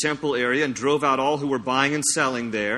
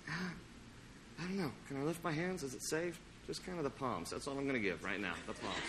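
much softer, hesitant and hushed; timid self-conscious worshipper; 2 semitones lower, 18 dB quieter, faster than usual. Retold or imitated. Preacher mocking a self-conscious churchgoer at worship. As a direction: hold back like you're afraid to be seen. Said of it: "We're like".

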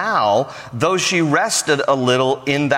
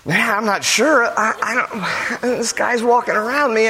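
self-conscious woman; 7 semitones higher than usual. Acted. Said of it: "You're just like".

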